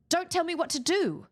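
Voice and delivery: bratty don't-tell-me tone; sassy retort. Retold or imitated. Talking back to Miles, mock defiant. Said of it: "And I was like".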